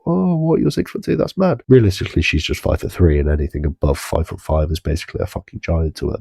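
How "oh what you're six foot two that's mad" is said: put-on high, wide-eyed girly voice; mock impressed girl. Mocking the girl who believes he is six-foot-two, high and gushing. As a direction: play her amazed and gushing. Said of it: "she might just not realise".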